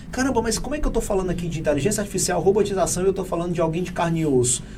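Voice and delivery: quick half-voiced skeptical aside; fast imagined objection. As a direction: rush through the listener's doubt. Voices the listener's imagined doubt.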